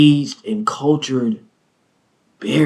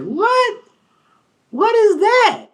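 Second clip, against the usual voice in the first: shrill disbelief, far higher; baffled high squeal; slower than usual. Retold or imitated. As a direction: squeak out the confusion. Baffled reaction in retelling.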